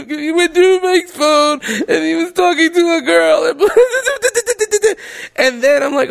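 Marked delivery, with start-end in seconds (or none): 0.1-3.5 high, slow whiny mock voice; squeaky retelling of her complaint; mock upset girlfriend. Quoting upset Courtney. 3.6-5.3 high-pitched giggle burst; high squealing laugh. Real, not acted. Laugh mid-story.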